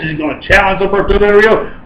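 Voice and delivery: ordinary talk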